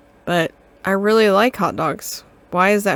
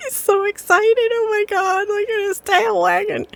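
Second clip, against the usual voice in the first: much higher, delighted cooing; gushing over dog; 12 semitones higher than usual. Real, not acted. Player watching the dog wag, high delighted cooing. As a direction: coo over the dog like it's adorable.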